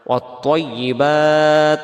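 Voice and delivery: slow chanted ওয়াত্তাইয়িবাত stopping on the final t; pausal ending. Shown as a demonstration. Teacher showing the stop at the end. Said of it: "দমটা ছেড়ে দিই".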